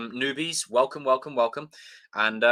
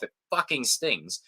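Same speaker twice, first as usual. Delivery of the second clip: ordinary delivery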